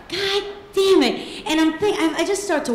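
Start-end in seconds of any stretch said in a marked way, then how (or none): 0.1-1.5 slow, high, fed-up groan; exasperated. Retold or imitated. Sister on the phone, slow exasperated curse. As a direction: let the fed-up sigh drag out slowly. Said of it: "And I was like".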